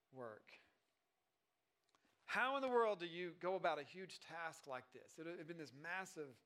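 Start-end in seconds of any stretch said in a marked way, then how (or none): none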